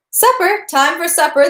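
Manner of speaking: high, loud call across the room. Acted. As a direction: announce supper to the whole room. Servant announces supper to players.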